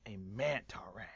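hushed reveal, breath only; dramatic whisper. Pretend. Streamer revealing his new form in a whisper. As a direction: whisper it like a secret.

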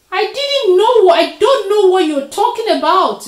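very high, very loud denial; loud, desperate self-defence; frantic protest. A narrator's reading. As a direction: protest innocence at full voice. Accused Leopard protesting innocence.

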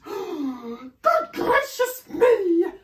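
very high, loud, slow cry; drawn-out, high-pitched alarm; shocked exclamation. A narrator's reading. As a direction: exclaim in theatrical horror. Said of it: "and exclaimed".

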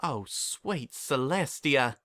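slow, drawn-out gasp of shock; stunned realization. Acted. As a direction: let it dawn on you slowly. Applejack notices lusting stare.

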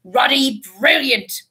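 slow, hearty boom. A narrator's reading. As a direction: boom it out over heads. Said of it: "boomed Hagrid".

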